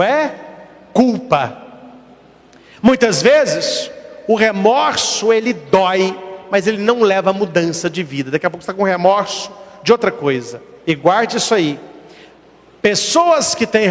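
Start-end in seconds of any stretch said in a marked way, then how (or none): none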